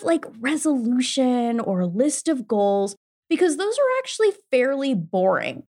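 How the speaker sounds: ordinary talk, nothing marked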